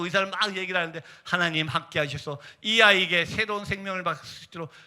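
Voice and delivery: normal talking voice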